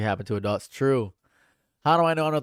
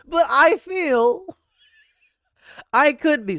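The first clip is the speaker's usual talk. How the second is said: much higher and louder, cheeky and pushing; excited rant; 11 semitones higher, 7 dB louder, slower than usual. Real, not acted. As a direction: get worked up, half joking, daring the chat. Worked up defending his point.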